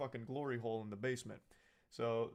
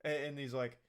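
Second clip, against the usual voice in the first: ordinary talk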